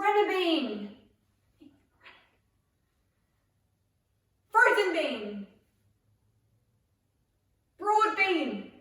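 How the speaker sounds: loud, high game commands; called out. Shown as a demonstration. Leader calls beans for players to act, called out loud and high. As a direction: belt out each name, pitch falling. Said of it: "so when I call broad bean".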